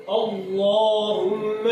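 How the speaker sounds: very slow "اللہم" with heavy, rounded "lloh"; dark lam in "اللہم", long held m; thick lam. Shown as a demonstration. Teacher giving an example children will question, thick lam. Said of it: "اللہم کو کیوں موٹا پڑھ رہے ہیں".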